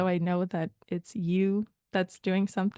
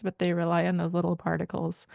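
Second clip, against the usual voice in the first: normal talking voice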